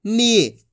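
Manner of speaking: "नी" stretched on a long ee; long drawn-out ee. Shown as a demonstration. Teacher answering how to say it, "नी" drawn out. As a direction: hold the vowel of "नी". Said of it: "खींच के पढ़ेंगे अपन इसको".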